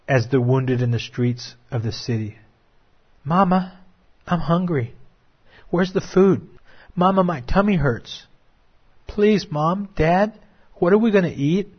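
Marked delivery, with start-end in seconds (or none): 3.2-11.7 lightly voiced pleading child, higher; preacher briefly voicing a hungry child asking mama for food; starving child. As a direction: beg like a hungry little kid. Starving child begging its mother.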